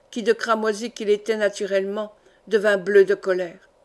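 ordinary delivery

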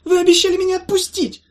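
very high, loud wail; trapped creature wailing; wailing protest. Acted. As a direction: howl the complaint. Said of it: "заголосил Гаулялей".

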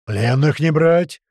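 slow, loud battle yell. Acted. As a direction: roar it across the mess hall. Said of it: "заорал на всю столовую".

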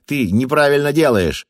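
high, loud panicky yell; frantic shout of protest; hysterical shouting. Acted. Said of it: "истерично закричал Вус".

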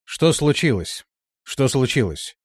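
cold, commanding. A narrator's reading. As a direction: demand an answer with cold authority. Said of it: "холодный и властный". (холодно и властно)

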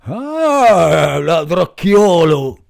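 loud, slow angry bellow; angry farmer. Acted. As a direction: roar at the thief. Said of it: "disse il contadino incollerito".

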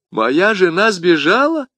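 high, slow incredulous question; astonished exclamation. A narrator's reading. Bonacieux learns his wife has escaped, astonished. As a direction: stretch it out in disbelief. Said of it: "воскликнул Бонасье".

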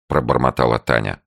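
usual speaking voice

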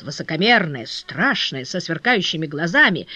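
usual speaking voice

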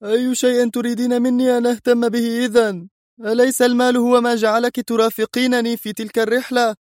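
put-on angry young man; angry shout. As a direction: snap back at her in anger. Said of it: "صاح في غضب".

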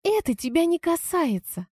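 high-pitched female character cutting someone off; snippy, high-voiced retort; curt rebuff. A narrator's reading. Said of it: "отрезала Лизка".

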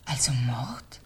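a faint, frightened whisper; stunned whisper. Acted. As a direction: breathe the question in disbelief. Widow realizing it was murder. (fassungsloses Flüstern)

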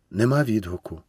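ordinary delivery, nothing marked

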